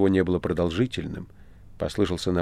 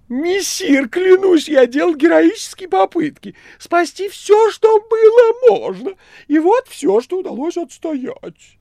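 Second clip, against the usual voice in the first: very high, loud wheedling voice; cat Behemoth, boastful; 8 dB louder, slower than usual. A narrator's reading. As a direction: overplay heroic self-praise. (кот Бегемот хвастливо)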